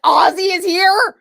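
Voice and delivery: high, loud disbelieving cry; shocked shout. Retold or imitated. Learning she will do Ozzy's makeup, shouting in shock. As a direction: blurt it out in total shock. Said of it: "And I'm like".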